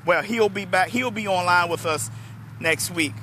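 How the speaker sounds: usual speaking voice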